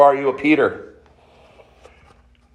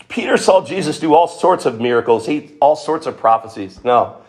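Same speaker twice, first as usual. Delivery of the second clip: ordinary talk, nothing marked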